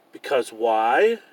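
ordinary delivery, nothing marked

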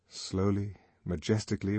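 ordinary delivery

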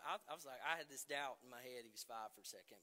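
ordinary delivery

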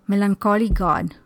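ordinary talk

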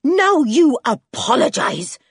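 loud, high, slow demand; angry ultimatum; trembling anger. A narrator's reading. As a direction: order it furiously, leaning on each word. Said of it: "trembling with anger".

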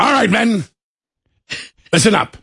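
rally the men like a commander; commanding general. Pretend. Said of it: "you walk out there and go".

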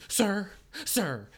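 hammed-up plea to an officer; mock pleading. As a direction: imitate an arrested man begging for attention. Arrested man pleads with officer.

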